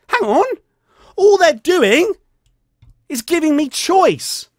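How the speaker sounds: the penny drops, lift the voice right up; sudden realisation. Retold or imitated. Sudden realisation inner thought.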